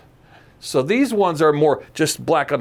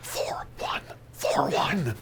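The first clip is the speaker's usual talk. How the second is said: whispered mock code; 4 semitones lower, 11 dB quieter, slower than usual. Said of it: "You're, like, going".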